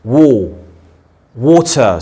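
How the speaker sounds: water, said slowly with rounded wo; slow mouth demo. Shown as a demonstration. Teacher shows the mouth for water. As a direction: open wide on the wo of water. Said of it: "you can just watch my mouse".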